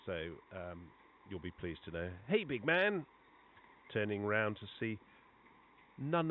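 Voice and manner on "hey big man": loud, high hail across a lobby; a cheery shout of recognition, no accent; friendly call out. Retold or imitated. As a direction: call out to a friend you spot. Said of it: "I'm not gonna do this in a West Indian accent".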